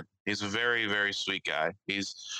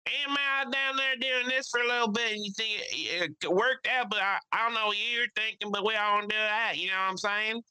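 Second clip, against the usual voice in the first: Mike's voice; 11 semitones higher than usual. Retold or imitated. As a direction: mimic the friend's thick country voice. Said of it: "the messages he sends just are like".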